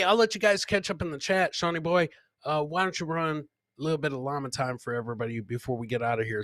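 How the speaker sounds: ordinary talk, nothing marked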